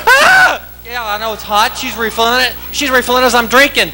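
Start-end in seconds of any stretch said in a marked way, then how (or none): 0.1-0.8 high yelp of pain, acted; scalded scream. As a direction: cry out from a burning sip. Scalded by first sip.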